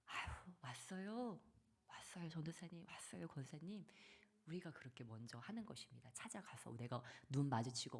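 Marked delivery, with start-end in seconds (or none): 0.1-3.8 a soft, unhurried hello with love; warm greeting. Shown as a demonstration. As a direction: greet them gently like the old professor. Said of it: "교수님처럼".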